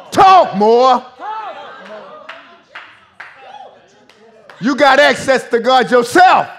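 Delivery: high, loud shouted exhortation; fiery pulpit shouting; full-force preaching. Real, not acted. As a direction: drive each phrase home at a shout.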